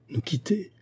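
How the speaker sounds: high, soft cry of distress; understated grief in a high-pitched question; pained cry. A narrator's reading. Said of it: "crièrent à la fois les deux femmes, ivres de douleur". (cri de douleur retenu, voix aiguë)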